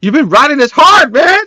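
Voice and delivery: high, loud protest; hammed-up yell of complaint; shouted complaint. Acted complaint by Elektra.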